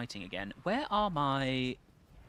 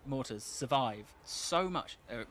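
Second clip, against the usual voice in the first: ordinary talk, nothing marked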